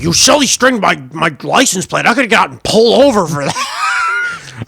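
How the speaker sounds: imitating a furious buddy, pitched right up; mad friend. Retelling a friend mad about his silly-stringed car, angry high yell. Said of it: "he was so fucking mad".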